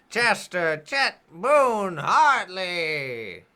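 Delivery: high, slow, drawn-out introduction; stage announcer. Acted. Host introduces keynote speaker at School of Enchantment, grand announcer. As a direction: build to a big, stretched-out name reveal.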